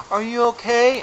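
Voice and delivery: high, lightly voiced ten-year-old; mock kid checking in as she zooms past; imitated little girl. Little girl to a crashed adult skier, imitated high child voice. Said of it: "this little ten-year-old girl who's zooming down".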